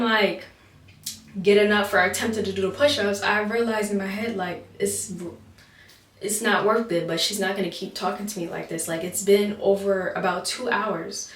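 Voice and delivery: ordinary talk, nothing marked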